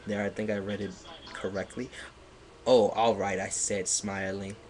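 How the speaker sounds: ordinary talk, nothing marked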